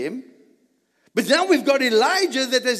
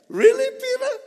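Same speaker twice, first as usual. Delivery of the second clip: mock surprise; 4 dB louder than usual. Real, not acted. Teasing question to Peter.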